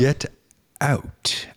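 "Get ... out" split by a pause, "out" softened; pronunciation attempt. Shown as a demonstration. Attempting the co-host's way of saying "Get out", carefully. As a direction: try out "out" slowly and separately.

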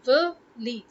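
"Walid" broken up as vuh, LEED; correct Walid. Shown as a demonstration. Teaching the name part by part, correct Walid. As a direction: say "Walid" in two clear parts, vuh-leed. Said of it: "It's Walid".

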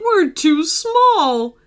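slow, high character voice for a dog; voiced dog character. A narrator's reading. Phoebe the dog speaks in story.

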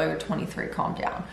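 ordinary talk

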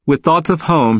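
ordinary delivery

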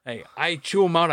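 usual speaking voice

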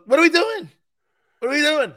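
high, loud imitation of a cocky friend; mock boisterous buddy. Buddy with chicken bucket barging into the gym, mock high yell. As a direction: pitch it way up and belt it out.

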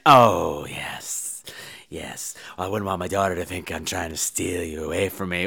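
near-whispered low seductress; hushed, flirting mother; seductive purr. Acted. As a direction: breathe it low and very softly. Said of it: "She starts trying to seduce the fiancé".

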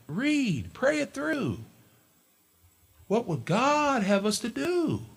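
high, earnest preaching; pulpit exhortation. Acted. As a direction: urge them to wait on God.

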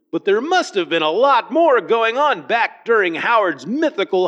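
put-on a light good old boy accent for Roy. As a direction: read Roy's line with a hint of a good old boy accent. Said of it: "shift into kind of a good old boy accent when I do accents".

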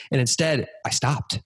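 normal talking voice

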